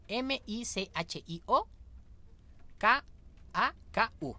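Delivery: each letter said separately and slowly, pauses between; spelling out. Shown as a demonstration. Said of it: "te lo voy a deletrear".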